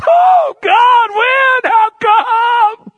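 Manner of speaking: loud high wailing cry to God; mock wailing plea. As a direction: ham up a desperate, whining prayer. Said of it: "They go".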